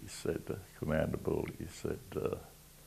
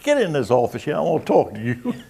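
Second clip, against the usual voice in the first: high, loud barked order with a laugh; a gruff boss calling him in, half laughing; commanding order; 9 semitones higher, 8 dB louder than usual. Retold or imitated. Commander orders the sailor into the office, loud and laughing.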